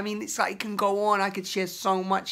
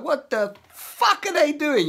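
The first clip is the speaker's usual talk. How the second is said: higher, louder outraged cry; pitched-up furious outburst; venting outrage; 9 semitones higher, 5 dB louder than usual. Pretend. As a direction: let the disbelief burst out at them. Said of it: "that's what wants to come out".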